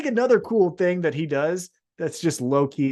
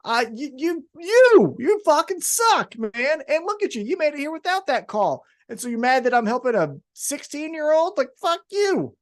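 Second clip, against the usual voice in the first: higher, mock-furious rant at a rival; mock angry rant; 7 semitones higher than usual. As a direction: rant like a riled-up wrestler on the mic, pitched up. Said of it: "And then Darby Allin goes".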